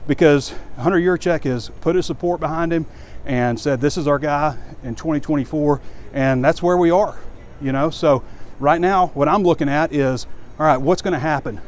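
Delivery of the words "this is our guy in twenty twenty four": ordinary delivery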